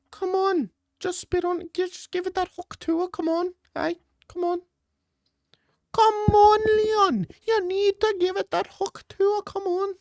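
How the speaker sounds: put-on falsetto coach egging a fighter on; Leon Edwards' coach. Coach urging Leon on from the corner, hammed-up falsetto pleading. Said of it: "Could you imagine Leon Edwards' coach".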